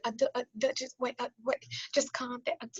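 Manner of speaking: small, soft stammering voice; imitating a timid, halting helper; nervous assistant. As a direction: stammer a hushed plea to calm down.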